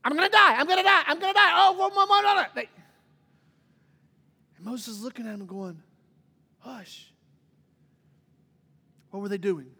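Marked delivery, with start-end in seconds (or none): 0.0-2.5 loud, high-pitched hammed-up hysteria; losing their mind. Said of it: "start losing their mind again". 6.6-7.1 very soft, calming "hush"; hushed command. Acted. Acting Moses quieting the panicking people.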